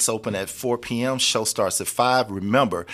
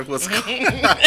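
laughing; 11 semitones higher, 5 dB louder than usual. Real, not acted. Laughing at on-air mishap.

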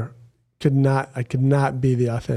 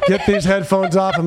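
mock outrage; 6 semitones higher, 4 dB louder than usual. Mock outrage at guest saying crap.

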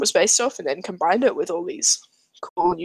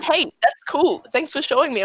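polite friend; 6 semitones higher than usual. Retold or imitated. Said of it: "and I'm not like".

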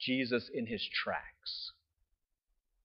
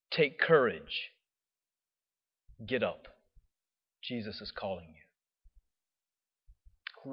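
lower, soft and very slow; gentle encouragement; 6 semitones lower, 6 dB quieter, much slower than usual. Retold or imitated. Crowd gently encouraging the blind man, soft. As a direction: coax him up tenderly.

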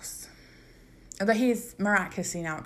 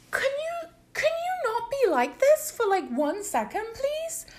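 very high, exasperated whiny plea; high pleading. Retold or imitated. Begging boyfriend to stop being like this, very high whine. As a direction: squeak it out, begging him to stop. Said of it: "I'm like".

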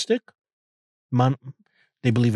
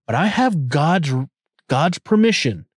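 normal talking voice

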